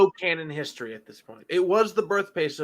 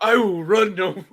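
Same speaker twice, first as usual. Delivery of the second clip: louder, higher grand movie delivery; dramatic hero line; 5 semitones higher, 7 dB louder than usual. Retold or imitated. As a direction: deliver it like a solemn last stand. Acting out Saw Gerrera's line.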